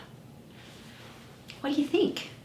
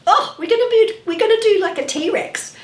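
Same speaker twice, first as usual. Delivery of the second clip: louder, higher burst of excitement; excited; 10 semitones higher, 6 dB louder than usual. Real, not acted. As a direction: announce it like a fun surprise. Excited announcing T-Rex move to kids.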